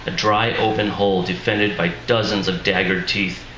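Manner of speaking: ordinary delivery, nothing marked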